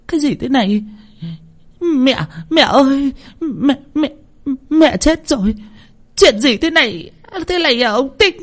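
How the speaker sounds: very high, horrified wife's voice; shrieking. A narrator's reading. Wife discovering mother-in-law is dead, shrieking. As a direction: shriek in shock at a very high pitch. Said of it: "Mụ hét lên".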